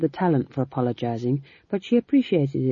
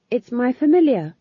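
high, louder defensive reply; 10 semitones higher, 7 dB louder than usual. A narrator's reading. Young witch defending her new familiar, defensively. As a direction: protect her pet from criticism. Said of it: "she said defensively".

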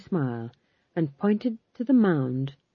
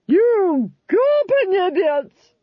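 slow, high, loud scornful insult; contemptuous Duchess; 14 semitones higher, 6 dB louder, much slower than usual. Acted. Duchess sneering at the crowd, slow, high and loud.

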